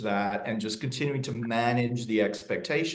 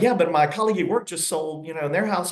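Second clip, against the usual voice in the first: higher, mildly whiny client objection; imitating a client; 7 semitones higher, faster than usual. Seller client objecting to the agent, higher and mock whiny. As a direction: lightly mimic a doubting seller.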